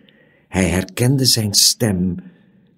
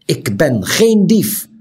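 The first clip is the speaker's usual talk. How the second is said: louder, slow indignant protest; crying out; 7 semitones higher, 6 dB louder, slower than usual. A narrator's reading. Loud protest. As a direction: Tiuri cries out that he is no thief. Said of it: "riep hij".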